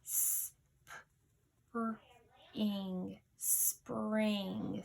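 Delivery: spring stretched out slowly; teacher stretches spring for spellers; slow, stretched word. Shown as a demonstration. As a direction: draw out spring, sound by sound. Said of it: "Number three was spring".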